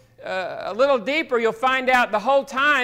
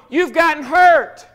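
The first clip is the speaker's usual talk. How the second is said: emphatic preaching; 3 semitones higher, 6 dB louder than usual. Real, not acted. Preaching to the congregation.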